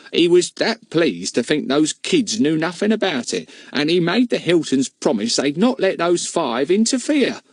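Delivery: a village woman talking over the fence; lightly voiced gossiping housekeeper; housekeeper's voice. Mrs Moon telling.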